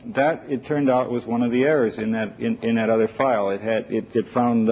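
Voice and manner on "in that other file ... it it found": ordinary talk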